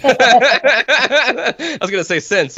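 words broken by big laughter; laughing. Real, not acted. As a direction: laugh through the line. Laughing mid-sentence.

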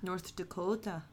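mock German accent on North Dakota. Shown as a demonstration. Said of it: "We can't just say everything in a German accent".

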